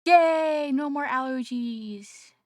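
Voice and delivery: loud, drawn-out happy yay; big celebratory shout, easing off; excited cheer. Real, not acted. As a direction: cheer it out, starting high and loud. Cheering the end of allergies.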